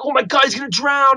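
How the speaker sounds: high, panicked retold cry; freaking out. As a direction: cry out in mock horror. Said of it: "I'm, like, freaking out".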